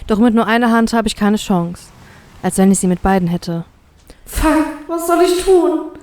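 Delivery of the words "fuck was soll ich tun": high, slow, helpless thought; desperate. A narrator's reading. Trapped captive thinking with no way out, desperate. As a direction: despair in a high, strained pitch. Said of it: "Nun gewinnt die Verzweiflung die Oberhand".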